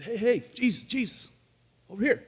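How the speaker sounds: high, eager call across a crowd. Acted. Man calls Jesus to heal his friend, eager high call. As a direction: hail him from a distance.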